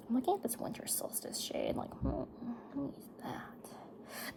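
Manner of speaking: whispering to herself while picking a shade. Real, not acted. Talking to herself over the palette, whispered. Muttering to self choosing eyeshadow.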